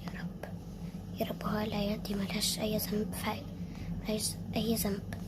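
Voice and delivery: low pleading whisper to God; quietly begging God for the children; whispered prayer. Acted. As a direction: pray softly under the breath. Praying for the children.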